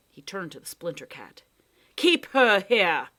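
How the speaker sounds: calm menace of a blackmailer; lightly voiced Proc. Proc tells Lindy and the cat his terms, firm.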